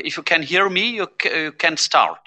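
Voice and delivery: ordinary talk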